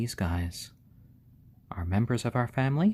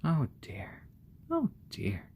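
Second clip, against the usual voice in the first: whisper; 6 semitones higher, 4 dB quieter, slower than usual. Real, not acted. Fussing over the chick.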